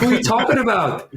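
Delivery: high, laughing disbelief; half-laughing, mock outrage. Retorting to a proud contractor, high and laughing.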